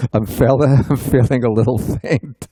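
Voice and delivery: amused, laughter breaking into the retold line; laughing retelling. Retelling a monk's words to the doctor at an autopsy, laughing. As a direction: quote it through chuckles.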